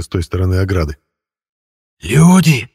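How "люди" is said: high rasping cry of delight; joyful hoarse wheeze. A narrator's reading. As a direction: wheeze it out gladly, loud. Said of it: "радостно просипело оно". (радостно сипя)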